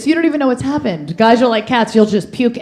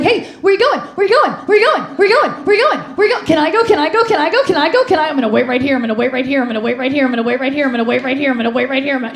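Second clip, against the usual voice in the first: high, rapid-fire eager yapping repeats; over-excited dog; 4 semitones higher, faster than usual. Acted. Women as dogs begging to go along, over-excited. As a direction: pester them like an excited dog, breathlessly repeating.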